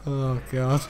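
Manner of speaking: normal talking voice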